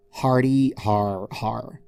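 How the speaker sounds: slow, mocking fake laugh; obnoxious sarcasm. A narrator's reading. Twilight mocking Discord with a fake laugh, sarcastic. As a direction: drip sarcasm on each har. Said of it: "with a distinct tone of obnoxious sarcasm".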